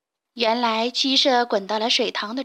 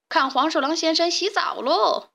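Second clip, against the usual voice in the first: gleeful call; 5 semitones higher than usual. Acted. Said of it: "小六子招呼大家".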